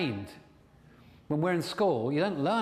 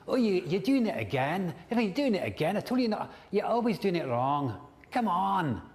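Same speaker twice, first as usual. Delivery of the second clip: higher nagging Scottish voice; Scottish accent; 5 semitones higher than usual. Shown as a demonstration. Coach shows clients a changed inner voice, nagging in Scottish accent. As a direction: nag in a put-on Scottish accent. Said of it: "a Scottish accent or something like that".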